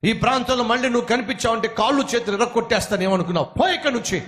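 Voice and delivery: high, furious tirade at the preacher; angry shouting. Acted. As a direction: yell him off the street, pitch way up. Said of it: "గట్టి గట్టిగా అరిచేస్తున్నాడు".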